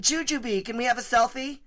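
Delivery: high-pitched pleading fan voice; eager fan. Retold or imitated. Acts out fans asking for selfie.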